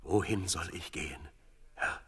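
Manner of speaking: softly spoken plea to God; humble question. Acted. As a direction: ask the Lord quietly and reverently. David humbly asks God for direction. (leise, demütige Frage)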